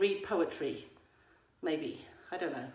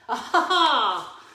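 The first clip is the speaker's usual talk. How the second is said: mock discovery; 5 semitones higher, 8 dB louder than usual. Real, not acted. Discovering next agenda item.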